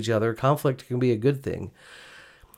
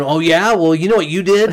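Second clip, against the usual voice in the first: louder, higher mock-defensive retort; defensive snap back; 4 semitones higher, 6 dB louder than usual. Husband imagines snapping defensively at wife, mock-defensive. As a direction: throw it back at her, hammed-up. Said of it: "and me going".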